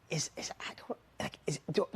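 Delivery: soft, halting false starts; retold tongue-tied muttering; hesitant stammer. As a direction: fumble quietly for the words, unsure. Unsure dad stammering to himself.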